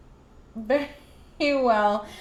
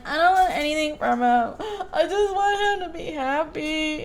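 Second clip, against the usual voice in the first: tearful, higher voice breaking into a laugh-cry; retold wish sobbed out higher; 5 semitones higher than usual. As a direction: choke it out through tears, half laughing. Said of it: "I'm crying my eyes out".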